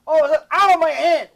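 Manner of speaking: put-on high, loud wail of a little boy; imitating crying toddler. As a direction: whine like a hurt two-year-old. Child voiced in story.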